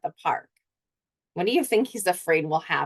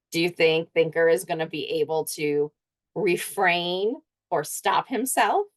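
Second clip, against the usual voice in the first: usual speaking voice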